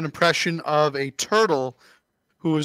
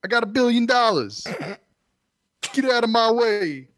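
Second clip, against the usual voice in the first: brash New York tough guy; New York accent; 6 semitones higher than usual. Acted. Took the New York part of that very seriously.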